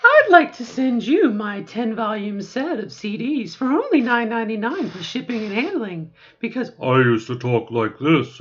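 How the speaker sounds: high, loud, syrupy sales pitch; voice-training huckster. Retold or imitated. Mocking online voice coaches selling a pitch in a hammed-up huckster voice. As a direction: oversell it like an infomercial. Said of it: "they'll say".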